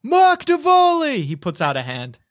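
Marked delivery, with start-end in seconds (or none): 0.0-1.2 high, drawn-out shouted name; yelling soldier. Acted. Soldier introducing himself to the reporter, shouting. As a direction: holler the full name over gunfire.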